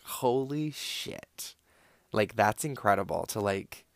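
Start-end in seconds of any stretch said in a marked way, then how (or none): 0.0-1.6 drawn-out, stunned "Holy shit"; slow awed disbelief. Retold or imitated. Reacting to the vodka and wine mix, slow and stunned. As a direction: let it out slowly in amazement. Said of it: "which I was like".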